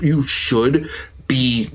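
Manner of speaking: normal talking voice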